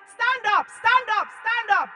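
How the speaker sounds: high, very loud pleading command; retold frantic shout. Woman urging the unconscious victim to rise, shouting. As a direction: yell at her to get up. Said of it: "I was shouting".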